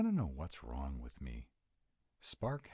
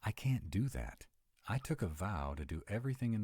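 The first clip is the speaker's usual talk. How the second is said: higher and firm, no room to argue; firm refusal; 6 semitones higher than usual. Acted. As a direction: plant your feet and refuse. Refusing to hide the threat.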